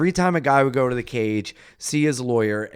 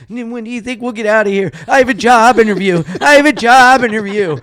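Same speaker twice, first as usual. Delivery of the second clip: high, fast, anxious pleading; nervous pleading; 9 semitones higher, 8 dB louder, faster than usual. Retold or imitated. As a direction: keep begging, louder and more desperate. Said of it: "I kept going, like".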